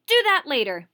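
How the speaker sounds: loud, high shout; angry shout. Acted. Teacher stopping the boy gathering coins, loud shout. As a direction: cut him off with a shout. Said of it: "Missus Wessex shouted".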